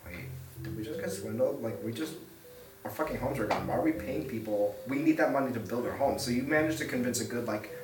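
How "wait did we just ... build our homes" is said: much softer, grumbling undertone; crowd murmuring. Acted. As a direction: mutter it like a doubting crowd. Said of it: "murmurings going across the crowd".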